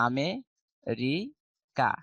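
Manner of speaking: আমেরিকা very slowly, split "a-me... ri... ka"; local pronunciation. Shown as a demonstration. Teacher showing how we say it, slow "a-me, ri, ka". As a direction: spell out আমেরিকা syllable by syllable with long gaps. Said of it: "আমরা কি বলি বলো".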